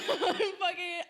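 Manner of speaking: high, slow, laughing hard; words squeezed out through laughter. Real, not acted. Telling friend the story, laughing high.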